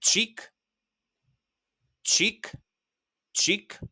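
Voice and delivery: çik repeated clearly; word demo. Shown as a demonstration. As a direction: model çik for the class. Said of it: "you might also hear these three words".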